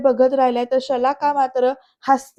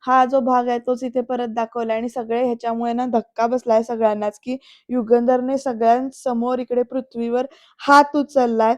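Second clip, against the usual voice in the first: usual speaking voice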